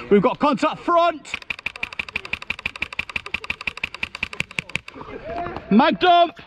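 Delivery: shouted callout. Real, not acted. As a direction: yell it over gunfire. Shouted callouts under fire.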